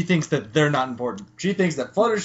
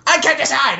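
high, loud panicked squeal; hammed-up indecision wail; mock panicked cry; 12 semitones higher, 6 dB louder than usual. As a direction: squeal it like a frazzled pony. Twilight panics at restaurant.

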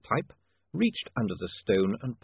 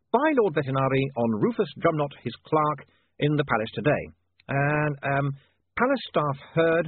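high, deliberate dictation to a typesetter; thinking out loud in bold headline style; William dictating headlines; 6 semitones higher, 4 dB louder than usual. Acted. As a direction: compose it aloud, drawing out the headlines.

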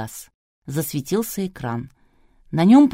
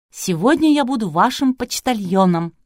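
higher, louder, cheery host announcement; joyful proclamation; 5 semitones higher, 5 dB louder than usual. A narrator's reading. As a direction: proclaim it gleefully to the audience. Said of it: "радостно провозгласила она". (радостно провозглашая)

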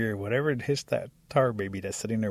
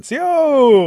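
very high, long, loud holler; louder call; 15 dB louder than usual. A narrator's reading. Rabbit trying the greeting on the silent creature, hollering louder. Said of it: "a little bit louder".

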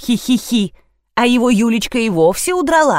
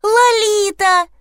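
very high, drawn-out squeal; child's squeal; 11 semitones higher, slower than usual. A narrator's reading. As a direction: squeal the name like an excited girl. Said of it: "завизжала Лиза". (восторженный детский визг)

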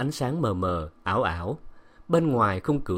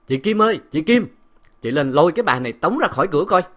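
loud, high shouted call, then rapid order; furious barked command; angry summons; 7 semitones higher, 7 dB louder, faster than usual. A narrator's reading. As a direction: yell for the housekeeper to come. Angry master calls housekeeper upstairs.

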